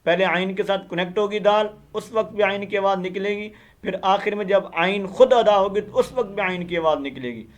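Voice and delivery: ordinary talk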